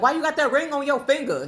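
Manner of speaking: mock confrontational demand from a stranger; aggressive old man. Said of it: "Why are you so aggressive?".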